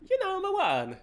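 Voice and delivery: lightly voiced Mario, pitched high; a quick high stab at Mario; Mario impression. Streamer trying Mario again for chat. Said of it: "my horrendous Mario impersonation".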